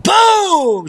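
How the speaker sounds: big shouted boom; triumphant boom. Acted. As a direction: slam it down like a reveal. Triumphant punchline.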